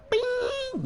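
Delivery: high, held "bing" like a chime; imitated bell ding. Shown as a demonstration. Counting off seconds to a new goofy, imitated ding. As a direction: ring out a high bell sound.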